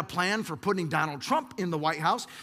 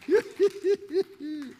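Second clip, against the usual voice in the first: a high, bright burst of laughter; joyful laugh; 9 semitones higher than usual. Real, not acted. Pastor laughing with delight after his peak.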